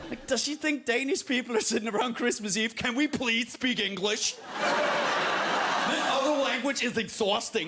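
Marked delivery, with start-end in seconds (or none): 2.7-7.7 mock pleading. Acting Danes weary of their language.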